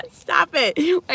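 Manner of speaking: high breathless laugh then a high, loud stop it; laughing, begging them to stop; laughing protest. Real, not acted. Laughing at viewer joke.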